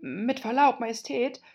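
very humble. Acted. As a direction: address the queen meekly. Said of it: "sagte Zwei sehr demütig".

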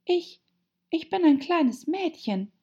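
high, halting, doubtful little voice; full of doubt. A narrator's reading. Alice answering the pigeon after all her changes, doubtful. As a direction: falter on the start, unsure. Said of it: "sagte Alice voller Zweifel".

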